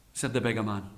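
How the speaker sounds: ordinary delivery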